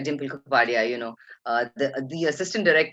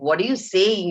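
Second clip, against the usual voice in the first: higher incredulous question; retold disbelief; 5 semitones higher, 4 dB louder than usual. Young server to chef over raw chops, incredulous. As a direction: act out being taken aback, pitched up.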